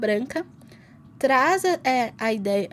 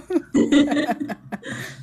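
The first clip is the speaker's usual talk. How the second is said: bursting into loud laughter; 4 semitones higher, 6 dB louder than usual. Real, not acted. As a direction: let a real laugh burst out. Laughing about dogs voting.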